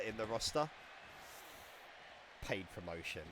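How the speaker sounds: ordinary talk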